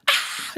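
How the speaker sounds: high-pitched squeal as a silly Batman; squeaky mock scream. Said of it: "Wouldn't Batman have a real high-pitched, squeaky voice".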